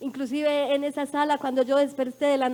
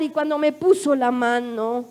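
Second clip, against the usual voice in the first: ordinary delivery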